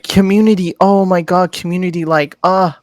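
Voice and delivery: high, gushing mock-sweet voice; gushing streamer. Mocking a happy-go-lucky streamer, high gushing voice. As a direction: parody a preachy positive streamer. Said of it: "she was one of those".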